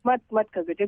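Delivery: ordinary talk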